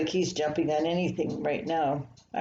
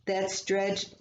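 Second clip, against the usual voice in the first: ordinary delivery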